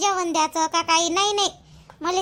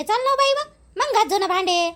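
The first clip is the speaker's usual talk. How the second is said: normal talking voice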